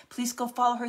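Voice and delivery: normal talking voice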